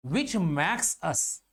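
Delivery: slow, spaced words for students to copy; slow dictation pace. Shown as a demonstration. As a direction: read it out slowly like notes. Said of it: "dictating notes".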